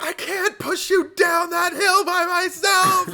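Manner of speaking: high, loud mock scream, drawn out slowly. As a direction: shriek it like a frantic sister. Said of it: "she's just screaming".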